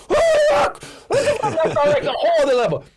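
very high, loud falsetto shriek; starstruck freak-out. Retold or imitated. Fan finding famous musicians backstage, starstruck shriek. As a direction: shriek it, voice shooting way up. Retelling his shock at seeing stars.

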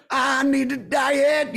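hammed-up diva complaint; mock whine. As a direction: lampoon the singer with a drawn-out whine. Mocking the singer's lyrics.

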